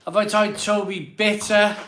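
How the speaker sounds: high and loud; loud question for the chat; reading the question aloud. Real, not acted. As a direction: read it out to the room. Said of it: "Let me ask my daughter".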